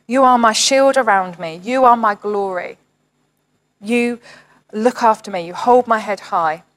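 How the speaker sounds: a measured, devout reading of the psalm; scripture reading. Acted. Pastor reading a psalm to the online church, devout. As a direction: read it like a prayer to God.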